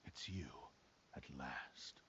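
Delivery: soft, dropping, sinister murmur; low menace. Acted. Possessed swordsman finally faces Kazuha, quiet menacing murmur. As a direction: let the words sink low and quiet, relishing the moment.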